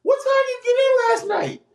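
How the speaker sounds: put-on high, fast girlfriend voice; high, quick mock-girlfriend interrogation; girlfriend's demand. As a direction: fire it off like his girlfriend.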